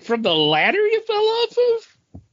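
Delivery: high, disbelieving question; incredulous. Retold or imitated. Cohost mocks his friend's ladder excuse, high and incredulous. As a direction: act shocked at the excuse. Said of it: "And I was like".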